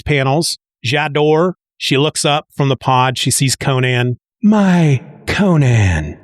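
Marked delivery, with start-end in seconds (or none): 4.4-6.2 very slow, drawn-out address; long, haunting call; acted eerie woman. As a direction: stretch out each word, falling away. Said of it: "she says".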